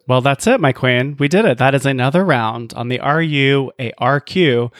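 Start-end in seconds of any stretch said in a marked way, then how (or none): none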